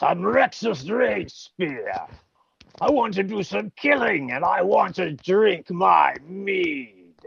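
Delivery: slow, high strained warrior growl; Rexus Rage Spear voice. Shown as a demonstration. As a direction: boom it out like a mead-drinking barbarian. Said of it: "he talks like this".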